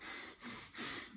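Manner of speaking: a long unvoiced huff of anger; angry breathy sigh. Boy held against the rail, angry huffing sigh. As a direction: huff out the anger without words. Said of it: "I'm just there like".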